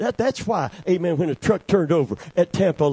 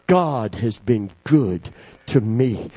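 slowed repetition; 9 semitones lower, slower than usual. Acted.

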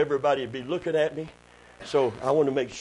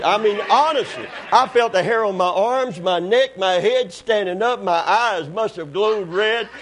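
ordinary delivery

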